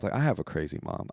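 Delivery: ordinary talk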